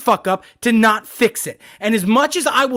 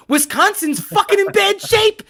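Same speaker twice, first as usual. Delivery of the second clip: high ranting yell; 9 semitones higher than usual. Real, not acted. Ranting at Aaron Rodgers.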